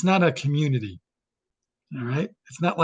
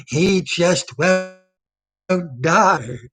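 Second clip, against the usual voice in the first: Bond villain; much slower than usual. Retold or imitated. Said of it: "a Bond villain petting a cat".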